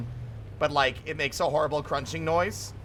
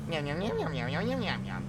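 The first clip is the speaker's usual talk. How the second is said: soft mewing yum yum; quiet cartoon cat yums; 7 dB quieter than usual. Voicing cat Nigel eating.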